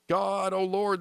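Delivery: high, lightly acted plea; pleading. A narrator's reading. Sailors crying out to God, pleading. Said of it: "they pleaded".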